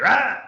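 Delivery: sudden high, loud snarl; aggressive dog snap. Retold or imitated. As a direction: imitate a dog lunging to bite. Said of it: "like, aggressively".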